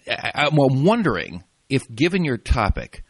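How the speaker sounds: usual speaking voice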